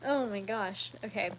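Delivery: ordinary talk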